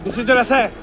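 loud call across street. Acted. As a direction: yell after the carriage. Chasing the carriage.

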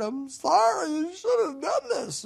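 a touch of a high pleading prophet; lightly voiced sorry Jonah begging; Jonah's prayer. Jonah pouring out his heart to God, mildly pleading. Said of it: "as he pours out his heart".

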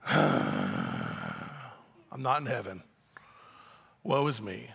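long low sighing groan, then a quiet mope; low, soft, mock-woeful lament; gloomy morning groan. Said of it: "just went".

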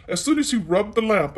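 plain reading voice

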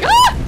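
sharp high loud scream; real scared scream; startled scream. Real, not acted. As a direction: shriek in sudden fright.

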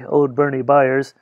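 usual speaking voice

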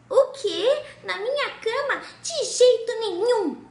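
high, loud indignant refusal; outraged princess. Acted. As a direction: shriek it like a princess who won't hear of it.

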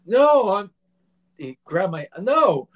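high, loud pained cry; shouted no. Retold or imitated. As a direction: cry out in shock. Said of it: "I'm like".

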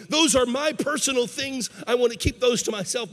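guard your privacy with sudden urgency; defensive. Acted. Man keeps one room from Jesus, defensive.

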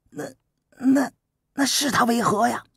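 put-on a puzzled boy tripping over his question; bewildered youth.

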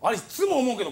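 ordinary talk, nothing marked

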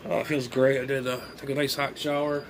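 normal talking voice

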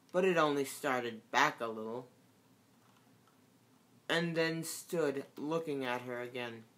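ordinary delivery

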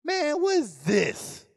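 high, slow mocking disgust; disdainful. Pastor scorning the tie he was given, high slow sneer. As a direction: sneer at the gift. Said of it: "I look at a tie".

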